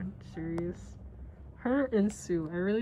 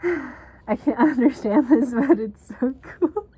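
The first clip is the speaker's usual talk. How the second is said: laughing; 3 semitones higher, 8 dB louder than usual. Real, not acted. Laughing at the Spanish text.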